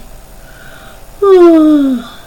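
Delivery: a long, loud, weary sigh; heavy sigh. Acted. Podkin sighing at the thought of the festivities, long loud sigh. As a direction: let out a big theatrical sigh. Reader sighs before the chapter.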